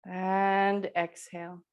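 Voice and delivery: slow, stretched exhale cue; drawn-out breath cue. Real, not acted. Yoga teacher pacing the class's exhale, slow and drawn out. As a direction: stretch the words to last a long breath.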